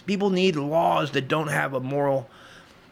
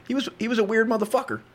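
ordinary talk

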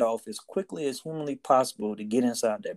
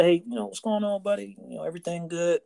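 bright, much higher, friendly check-in; upbeat greeting; 8 semitones higher than usual. Retold or imitated. Sailor cheerily greeting a defeated young shipmate, higher and friendly. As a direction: perk him up with a cheery hello.

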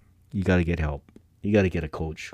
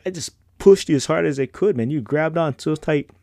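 instructor ribbing; 7 semitones higher than usual. Retold or imitated. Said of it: "he said".

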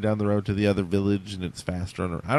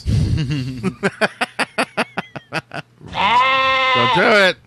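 mock cry; 10 semitones higher, 5 dB louder, much slower than usual. Mock cry amid crickets joke.

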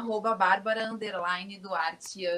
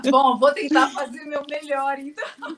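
laughing; 3 semitones higher, 7 dB louder than usual. Real, not acted. Laughs at the joke.

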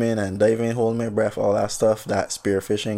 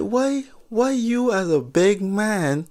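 much higher, stretched-out disbelief; incredulous high cry; 10 semitones higher, slower than usual. Retold or imitated. As his grown friend jumps on him, high incredulous cry. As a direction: squeal it in disbelief.

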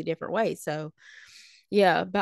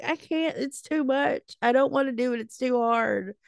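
high-pitched mock whine; hammed-up complaint of being overwhelmed; whining; 5 semitones higher than usual. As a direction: plead helplessly, pitched up. Retold complaint about honors class.

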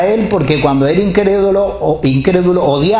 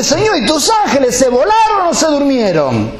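high, laughing complaint to the Lord; amused, half-joking reproach; laughing mock prayer; 10 semitones higher than usual. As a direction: tease God through a laugh.

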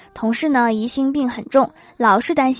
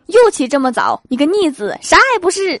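mock scolding, climbing higher to the end; dad scolding; 5 semitones higher, 4 dB louder than usual. Dad scolds son for waking early, mock angry. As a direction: scold like a fed-up father.

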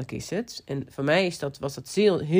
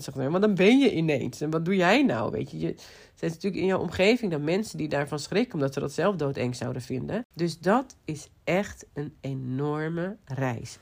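usual speaking voice

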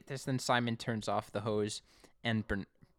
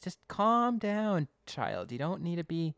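mock calming; 6 semitones higher than usual. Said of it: "I'm like".

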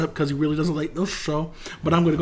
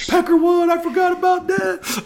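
delighted. Real, not acted. Delighted recognition.

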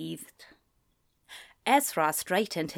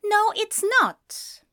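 high, slow denial; insistent, drawn-out protest; stubborn contradiction; 13 semitones higher, 3 dB louder, slower than usual. A narrator's reading. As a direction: bicker back in a higher voice. Acted bickering between two friends.

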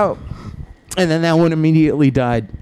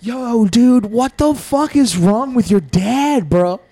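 higher, alarmed yelling at a friend; freaked-out kids; 7 semitones higher than usual. Retold or imitated. Kids freaking out to a friend about his dad, higher. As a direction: panic about your buddy's dad. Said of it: "We were like".

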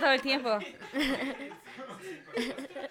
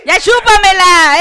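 loud, slow, higher shout; mock crude shout; 6 semitones higher, 13 dB louder, slower than usual. Real, not acted. Teasing the lovey-dovey couple, loud crude shout. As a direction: yell at the lovebirds to shut up. Friends mocking the sweet couple.

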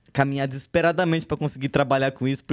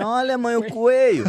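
high, laughing mineiro accent mimic; playful imitation of the Minas Gerais accent; 10 semitones higher, 4 dB louder than usual. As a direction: point and exclaim like a mineiro kid. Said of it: "os mineiros olhavam e falavam assim".

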